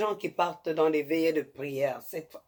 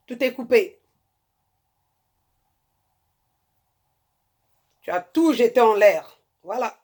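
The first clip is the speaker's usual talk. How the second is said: higher, louder exhortation in rising bursts; impassioned sermon voice; fervent preaching; 5 semitones higher, 4 dB louder than usual. Acted. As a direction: preach it at the women like a high priestess. Preaches to women about marriage.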